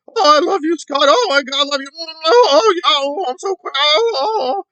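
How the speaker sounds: hammed-up high gushing swoon, moaning oh; mock her gushing over Scott, pitch way up and loud; mock swooning fangirl. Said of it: "gonna act like".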